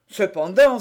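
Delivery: loud, indignant protest; exclamation. A narrator's reading. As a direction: cry out in objection. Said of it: "s'écria Porthos". (protestation sonore)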